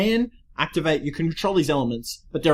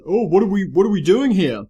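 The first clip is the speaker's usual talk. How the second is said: raised, louder complaint in a loudmouth's voice; 6 semitones higher, 6 dB louder than usual. Acted. Podcaster acting out Wheeler arriving confused on the island, loudmouth. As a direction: act out a loudmouth, higher and louder. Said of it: "is a loudmouth".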